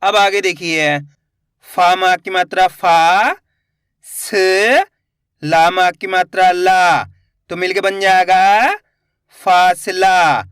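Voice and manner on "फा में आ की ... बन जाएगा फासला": slow reading drill, long-held vowels; letter-by-letter demo. Shown as a demonstration. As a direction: spell out each sound, then blend slowly. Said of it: "एक एक अक्षर का उच्चारण करके". (एक-एक अक्षर खींचकर, धीरे)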